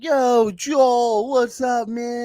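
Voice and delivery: high, bright mock friendly greeting. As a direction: gush a warm hello. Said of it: "[name] is like".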